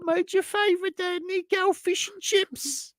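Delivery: very high mock British, slow on fish and chips; sing-song posh mum offering dinner. Mum telling Nico she made his favourite, in a high mock British accent. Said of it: "a little Australian when you do that".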